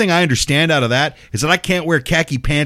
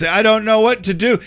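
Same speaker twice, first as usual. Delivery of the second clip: mock tearful; 8 semitones higher than usual. Internet celebrities melting down on camera, mock tearful. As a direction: put on a helpless near-crying plea. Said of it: "You think they're crying now?".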